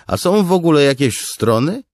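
very high, loud challenge. A narrator's reading. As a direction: push the question up high and loud. Drej challenges with a question.

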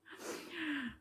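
soft, high falling chuckle; self-amused laugh. Real, not acted. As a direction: laugh quietly at yourself. Amused at own short temper.

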